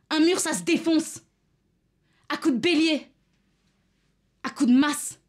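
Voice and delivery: high, loud, impassioned outburst; angry rallying shout. Acted. Activist rousing weary women to smash walls, enraged shouting. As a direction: shout it to rouse the whole crowd.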